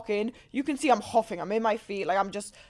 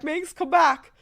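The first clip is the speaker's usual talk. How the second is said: calling out; 5 semitones higher, 5 dB louder, slower than usual. Retold or imitated. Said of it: "they're like".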